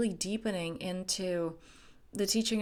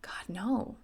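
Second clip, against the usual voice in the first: whispered no; 3 dB quieter than usual. Real, not acted. Emphatic denial aside.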